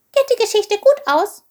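high little voice, a touch anxious; unsure question. A narrator's reading. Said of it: "meinte Chilp unsicher".

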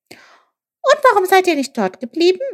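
small, high, spent voice; weary, high-pitched question. A narrator's reading. Chilp wearily asks the jackal why they left, high and faint. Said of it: "fragte Chilp matt".

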